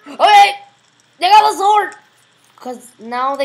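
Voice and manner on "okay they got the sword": loud, high panicked shout; alarmed yelling. Real, not acted. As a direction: yell out a sudden warning. Alarmed as enemies come after player.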